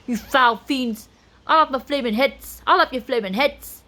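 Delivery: loud, high Scottish battle cry; Scottish accent. A narrator's reading. Spoon threatens the creatures, fierce Scottish accent. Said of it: "Scottish accent".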